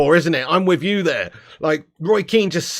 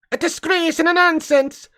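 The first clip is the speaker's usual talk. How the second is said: much higher, Irish-flavoured rant voice; Roy Keane impression; 12 semitones higher than usual. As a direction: growl out a disgusted pundit rant as Roy Keane. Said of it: "Roy Keane should be throwing punches".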